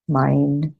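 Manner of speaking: normal talking voice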